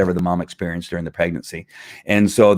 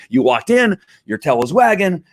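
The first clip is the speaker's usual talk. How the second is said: high-pitched, delighted praise; praising the dog; 10 semitones higher than usual. Acted. Owner rewarding a dog who walked in, high happy praise. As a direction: gush to a dog in a raised voice.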